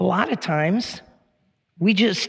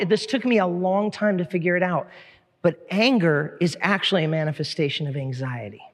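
ordinary talk, nothing marked

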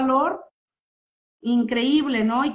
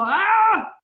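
sharp exasperated ah; 8 semitones higher, 5 dB louder than usual. Retold or imitated. Reacting to a triggering emotion.

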